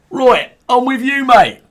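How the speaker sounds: high squeaky soldier voice. Acted. Soldier agreeing to flee.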